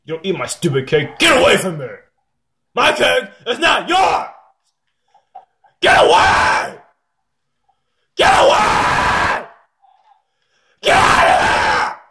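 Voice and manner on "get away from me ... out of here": high, furious yells building to screams; furious screaming. Real, not acted. Player driving someone away from the cake, furious screaming. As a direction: build from an angry yell to a raw scream.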